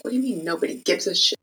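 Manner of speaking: quick, hushed disbelieving retort; retold indignation. Retelling a reply to the designer, hushed fast disbelief. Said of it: "I was so mad".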